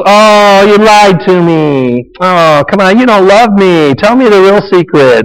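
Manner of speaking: high, drawn-out whining plea; whiny Delilah. Retold or imitated. Delilah pressures Samson for his secret, whining high. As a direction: whine it like a nagging temptress. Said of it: "now she's whining again".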